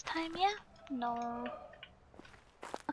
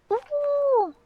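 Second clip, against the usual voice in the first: delighted; 7 semitones higher, 8 dB louder, much slower than usual. Real, not acted. Sees pretty puzzle scenery.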